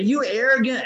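bristling at the insult; indignant thought. Retold or imitated. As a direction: inner outrage at the sponsor. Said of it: "I thought".

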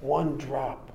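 quiet, slow, unbothered doctor; calm doctor. Retold or imitated. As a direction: answer softly and deliberately, totally calm. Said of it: "He says".

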